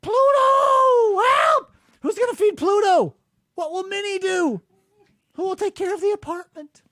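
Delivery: very high mock cartoon panic; mock Mickey pleading. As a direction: wail for Pluto like a scared cartoon. Mimicking Mickey-voiced pleading.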